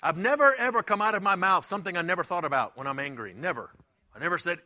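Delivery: usual speaking voice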